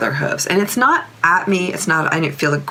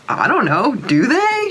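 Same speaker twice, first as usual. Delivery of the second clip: high, playful echo of the question; a hint of a puzzled horse; mock puzzled; 7 semitones higher than usual. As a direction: toss the question right back, lightly voiced. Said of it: "She asked me back".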